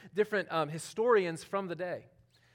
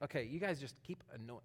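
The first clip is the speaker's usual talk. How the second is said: annoyed; 6 semitones lower, 13 dB quieter than usual. Retold or imitated. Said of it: "Like, no".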